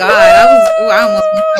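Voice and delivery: high, loud excited squeal; excited shriek. Real, not acted. Excited shout after guessing.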